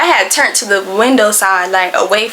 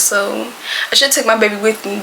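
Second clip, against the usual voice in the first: normal talking voice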